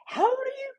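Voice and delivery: put-on the girls gasp how old he is; mock shocked squeal; astonished question. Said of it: "basketball girls were like".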